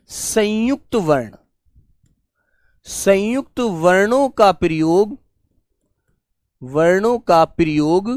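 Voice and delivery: teacher dictating a heading; slow dictation. Shown as a demonstration. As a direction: read out slowly for pupils to write down. Dictating notes to students.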